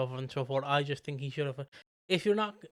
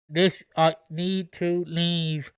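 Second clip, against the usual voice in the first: drawn-out, louder mock garbled phone voice; mock distorted voice; 5 dB louder, much slower than usual. Character phoning Callum to say he's leaving, mock distorted voice. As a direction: imitate a distorted caller, stretching each word slowly. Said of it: "you just get a distorted voice going".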